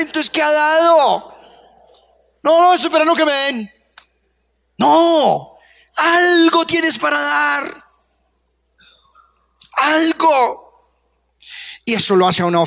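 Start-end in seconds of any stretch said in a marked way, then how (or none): 0.0-10.9 preaching shout. Acted. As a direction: press them hard on what they give. Preacher challenges congregation.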